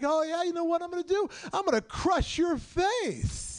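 taunt, then draw out the threat; retold threat. Angry shopper escalating on the comic, mock menace.

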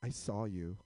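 put-on low deadpan kid's accusation. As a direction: drop low and say it with zero expression. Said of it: "Like, just deadpan".

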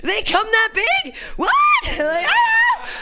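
hammed-up screaming panic; mock horrified shriek. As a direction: shriek at the sight like a horror victim. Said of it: "I'm like".

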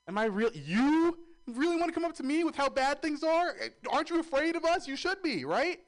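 high, loud hammed-up indignation; exaggerated disbelief at her; mock outrage. Rant at asking woman.

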